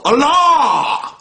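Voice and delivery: loud, high, drawn-out cry; hammed-up, soaring holy proclamation; mock-grand exclamation. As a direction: boom the name out, stretched long and high. Mock-grand cry of God's name.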